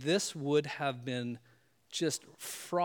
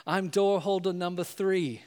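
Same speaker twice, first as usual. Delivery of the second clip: put-on proud kid voice; 4 semitones higher, 4 dB louder than usual. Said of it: "finally he goes".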